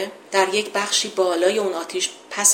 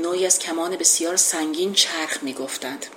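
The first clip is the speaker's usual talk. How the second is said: ordinary talk, nothing marked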